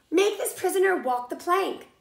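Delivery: loud command. Acted. Captain giving orders.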